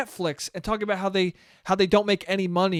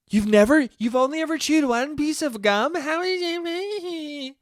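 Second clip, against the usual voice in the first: rising higher into squeaky incredulity; mock disbelief; 7 semitones higher than usual. Dating app match doubting his gum fact, mock disbelief climbing higher. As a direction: mimic someone who can't believe it. Imitating incredulous people again.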